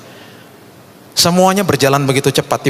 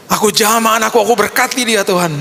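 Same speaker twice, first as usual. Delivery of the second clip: high-pitched re-enacted blessing; fervent blessing prayer; 8 semitones higher than usual. Father blessing the child before God, fervent retold prayer. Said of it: "kami berdoa dan saya katakan".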